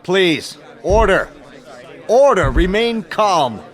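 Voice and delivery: loud, high call for order, slowing at the end; shouting for order. Acted. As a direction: raise the voice over the hall. Chair shouts over noisy assembly.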